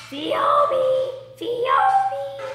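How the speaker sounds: very high, loud scream of a name; foghorn scream. A narrator's reading. Enzo calling for missing Phoebe over the crowd, screaming. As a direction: scream it out like a little human foghorn. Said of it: "the boy with the foghorn voice".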